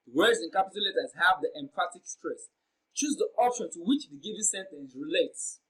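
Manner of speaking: ordinary delivery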